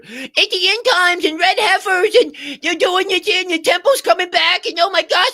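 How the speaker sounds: shrieking parody of a clout-chasing prophet of doom; mocking hysterical fear-monger. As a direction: ham up a breathless doomsday alarmist. Mocking end-times fear-mongers.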